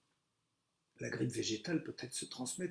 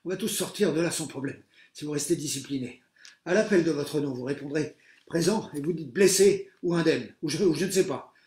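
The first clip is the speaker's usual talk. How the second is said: loud, high commanding teacher over the din; calm them by shouting orders; teacher taking charge in the rubble; authoritative teacher; 4 semitones higher, 11 dB louder than usual. A narrator's reading. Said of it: "Une voix chaude retente".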